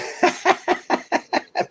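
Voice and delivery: breathy laugh bursting out; real laugh. Real, not acted. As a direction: laugh at the doggy Band-Aids. Laughing at a donation message.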